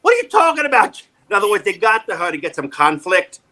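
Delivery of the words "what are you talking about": indignant mock outrage, much higher; outraged disbelief. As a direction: explode in shocked disbelief. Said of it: "I'm going".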